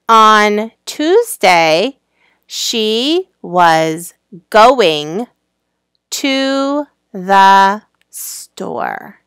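very slow, word by word; slow reading aloud. Shown as a demonstration. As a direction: read it out slowly for beginners. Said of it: "So this says".

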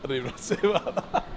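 normal talking voice

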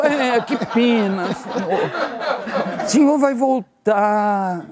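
very slow, high, mock-sorry voice; pitying clerk. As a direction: drag out the pity, hammed-up. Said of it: "Aí ela falou".

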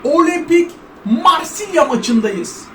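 slow announcer opening; hammed-up sports commentator intro; mock commentator. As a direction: open the match like a TV commentator. Commentator-style match intro.

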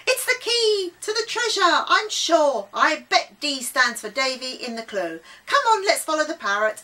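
high, loud, eager shout; excited yell. A narrator's reading. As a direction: yell it out, thrilled by the find. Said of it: "yelling excitedly".

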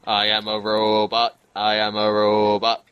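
normal talking voice

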